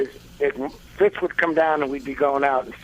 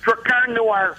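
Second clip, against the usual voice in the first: put-on high, punchy Chicago-accented name; 10 semitones higher, slower than usual. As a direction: hit it like a Chicago guy. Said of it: "say the words Drakkar Noir in character".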